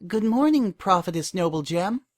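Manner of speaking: a hint of a small bowing filly; young filly. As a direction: politely greet the prophetess like a keen young acolyte. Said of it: "Bowing, the filly said".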